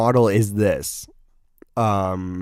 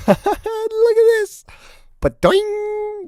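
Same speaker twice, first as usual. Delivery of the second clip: squeaky laugh; 4 dB louder, much slower than usual. Real, not acted. Laughing at the worst car.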